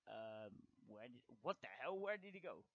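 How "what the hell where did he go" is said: ordinary talk, nothing marked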